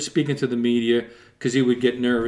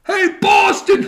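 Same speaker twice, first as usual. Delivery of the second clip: much higher, loud mocking yell; teasing shout; 5 dB louder, slower than usual. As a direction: holler it across the rink. Player yelling each lap.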